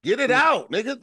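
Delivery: loud, high-pitched outburst; sudden exasperated yell; impatient heckle. Real, not acted. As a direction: shout at a friend to spit it out. Heckling a stuttering friend.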